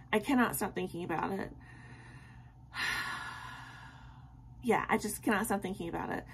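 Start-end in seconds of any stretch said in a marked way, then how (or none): 2.7-4.0 long soft unvoiced sigh; wistful sigh. Real, not acted. Vlogger sighing over a K-drama she misses, dreamy sigh. Can't stop thinking about drama.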